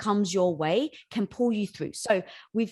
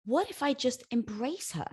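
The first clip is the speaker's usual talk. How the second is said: ordinary delivery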